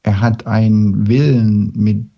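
ordinary delivery

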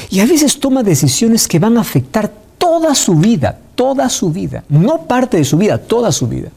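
toda punched each time, su vida trailing; stress on toda. Acted. Preacher to congregation, stress on toda. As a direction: hammer the word toda, not parte.

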